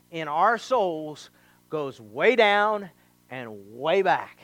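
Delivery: slowed, each phrase drawn out; preacher stretching the point; slow emphasis. Acted. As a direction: drag it out, way down, way back.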